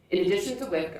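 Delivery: ordinary delivery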